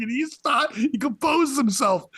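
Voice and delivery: normal talking voice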